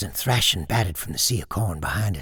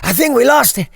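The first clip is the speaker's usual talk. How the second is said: gasping; 9 dB louder than usual. Acted. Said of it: "gasped Huff".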